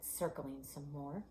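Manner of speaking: ordinary delivery, nothing marked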